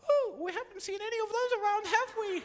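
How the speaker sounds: very high, fast, tiny mouthed squeak; squeaky frog voice. Acted. Frog suddenly hides its wide mouth from the crocodile, squeaking high. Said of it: "the wide-mouthed frog says".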